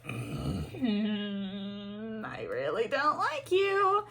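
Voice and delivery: soft, slow mock distaste; drawn-out murmur of mock distaste; quiet disgust. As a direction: murmur the dislike slowly. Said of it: "really was like".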